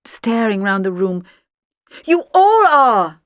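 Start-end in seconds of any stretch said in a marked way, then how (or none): 2.0-3.2 high, slow loud shout. A narrator's reading. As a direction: cry it out to the whole room. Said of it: "I shouted".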